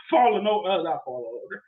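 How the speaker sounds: ordinary talk, nothing marked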